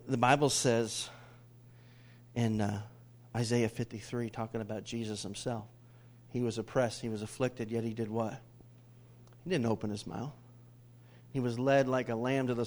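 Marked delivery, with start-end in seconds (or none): none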